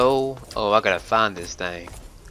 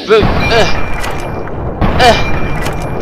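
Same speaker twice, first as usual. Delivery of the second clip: frustrated shout; 10 semitones higher, 10 dB louder, much slower than usual. Real, not acted. Loud high frustration.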